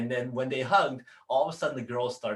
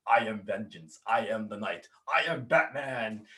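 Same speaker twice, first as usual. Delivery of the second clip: lightly imitated superhero declaration; solemn catchphrase, slowing on the last line; Kevin Conroy's Batman. Actor proving himself at the microphone, imitated Batman declaration. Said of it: "did his legendary".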